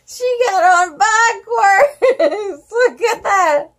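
high, sing-song laughing. Real, not acted. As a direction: giggle through it. Laughing at the story.

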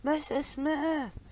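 long high drawn-out call; high, slow call across a crowded observation deck; shouted name. A narrator's reading. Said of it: "took a deep breath and shouted".

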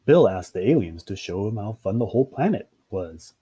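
plain reading voice

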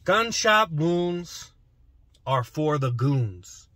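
slow, drawn out rhyme; slow rhyming slogan. Shown as a demonstration. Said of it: "I tried to rhyme it".

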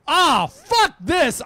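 slow loud disgusted shout; fed up. Retold or imitated. DJ walking out of the booth, loud fed-up shout. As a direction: spit it out and storm off.

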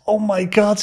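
high-pitched acted amazement; astonished. Mate spotting him in New York, acted astonishment. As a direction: imitate a mate's delighted shock.

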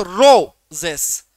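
"Roses" with the first syllable "रो" loud and drawn out; stressed first syllable. Shown as a demonstration. Teacher showing a trochee example, first syllable of "roses" stressed hard. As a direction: punch "रो" in "roses", then drop off on "जेस". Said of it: "ट्रोकी के".

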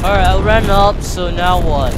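loud, high frantic gamer; frantic shouting. Real, not acted. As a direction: shout it while fleeing. Frantic gamer after running.

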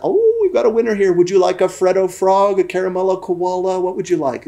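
high, delighted customer-service voice; shop assistant. Retold or imitated. Shop lady offering a child his prize, high sing-out welcome. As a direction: gush over the little winner.

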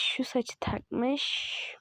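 slow drawn-out words. Real, not acted. Describing the avatar's hair, slow and drawn out. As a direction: stretch şu saçı takmış out, slow and soft.

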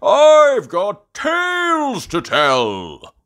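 slow, loud, high storyteller's boast; Grampy Rabbit. Acted. Grampy Rabbit offers his visitors tales, grand loud voice. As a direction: proclaim it grandly, stretching the words. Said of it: "says Grampy Rabbit".

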